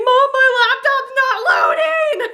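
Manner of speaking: very high, drawn-out wail; tearful panic. Retold or imitated. Daughter to mom while finding out role, panicked wail. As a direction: whine it desperately, acting out hysterics.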